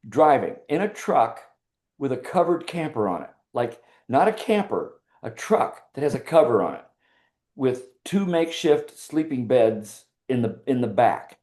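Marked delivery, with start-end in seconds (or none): none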